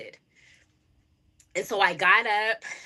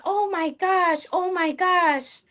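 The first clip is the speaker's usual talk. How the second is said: high, slow, drawn-out gasp of a mother; mother hearing her daughter's confession, high slow dismay; shocked mom; 11 semitones higher, slower than usual. Retold or imitated. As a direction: stretch it out like a stunned mother.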